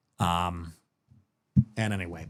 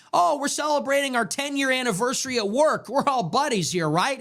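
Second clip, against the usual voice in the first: mock chummy voice; 10 semitones higher than usual. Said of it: "Like we're all buddies".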